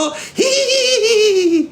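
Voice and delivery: very high, sustained mock giggle; exaggerated high-pitched fake laugh; imitated laugh. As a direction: mimic her laugh as one long squeal. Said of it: "شفتوا بس تضحك انتو؟".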